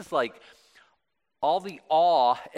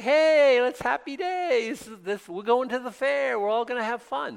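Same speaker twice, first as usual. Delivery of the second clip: high, bouncy mock party voice, much higher; hammed-up carefree excitement, pitched way up; mock cheerful; 10 semitones higher, 6 dB louder than usual. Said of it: "it wasn't something like".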